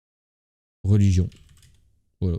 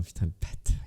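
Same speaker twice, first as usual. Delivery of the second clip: muttered under breath; 6 semitones lower, 8 dB quieter than usual. Real, not acted. Muttered swear at a rival.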